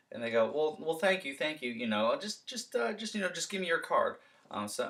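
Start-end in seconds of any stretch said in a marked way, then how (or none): 0.5-4.2 stalling homeowner. Shown as a demonstration.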